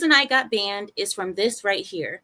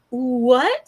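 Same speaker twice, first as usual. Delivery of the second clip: surprised; 3 semitones higher, 6 dB louder than usual. Retold or imitated. Hears she is banned.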